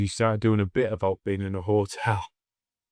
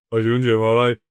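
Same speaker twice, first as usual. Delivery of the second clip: a hearty, raised-voice hello in retelling; loud retold greeting; 8 dB louder, faster than usual. Older comic greets the young act backstage, loud greeting. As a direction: call it out, imitating the older comic. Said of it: "And he goes".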